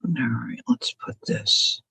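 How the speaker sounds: soft whispered self-talk. Real, not acted. Crafter placing lace, whispering to herself. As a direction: murmur it under the breath, focused.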